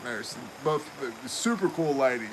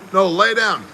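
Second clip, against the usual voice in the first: louder, higher snap of an order; firm nurse order; 5 semitones higher, 8 dB louder than usual. Retold or imitated. Little nurse telling the patient to lie down, firm order. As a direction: push him back down with the words.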